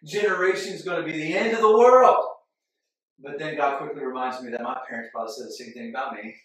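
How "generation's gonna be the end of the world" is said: exasperated. Retold or imitated. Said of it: "and you're just like".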